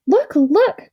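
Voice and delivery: high and loud, eager cry; excited cry. A narrator's reading. Dickory to the girl, spotting the ring, excited cry. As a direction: call out, thrilled by what you spot. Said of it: "cried Dickory".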